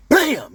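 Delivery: high, loud "bam" of impact; imitated crash. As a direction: punch out the crash sound. Voicing calculator hitting the wall.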